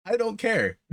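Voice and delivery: sudden high, loud blurt; "I don't care" yelped high and loud, then a laugh; defiant outburst. Real, not acted. Defiant outburst in podcast intro.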